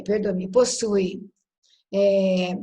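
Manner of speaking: ordinary talk, nothing marked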